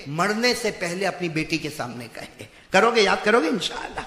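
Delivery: normal talking voice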